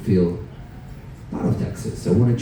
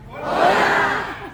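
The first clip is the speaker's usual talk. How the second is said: high, stretched hola call; high hola cheer. Shown as a demonstration. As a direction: sing out hola to the room. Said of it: "I need all of you to go".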